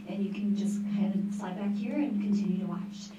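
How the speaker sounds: normal talking voice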